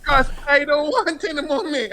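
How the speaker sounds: put-on high, fading child's plea; high mock whine; pleading son. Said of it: "son repeats refusal".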